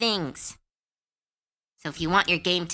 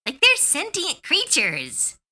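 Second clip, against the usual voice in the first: high, slowed shocked realization; dawning realization; 10 semitones higher, slower than usual. Retold or imitated. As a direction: realize the monsters are people. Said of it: "that moment of going".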